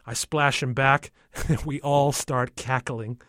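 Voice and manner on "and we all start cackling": plain reading voice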